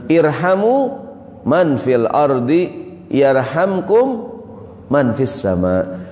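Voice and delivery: slow, drawn-out measured recitation; Arabic hadith recitation. Acted. Preacher reciting the Prophet's saying to congregation, slow recitation. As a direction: recite it solemnly, stretching the long vowels.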